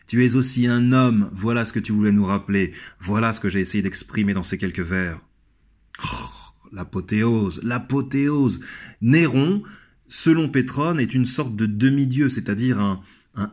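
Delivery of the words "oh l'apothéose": usual speaking voice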